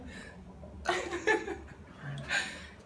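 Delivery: quiet real laughter; breathy laughter. Real, not acted. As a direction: let out a silent laugh. Laughing at patient's gag.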